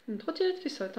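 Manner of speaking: « Une trottinette qui saute » repris plus haut et plus doux, attendri et amusé; répéter l'idée de l'enfant en s'en amusant; amusée. Real, not acted. (reprise amusée et attendrie)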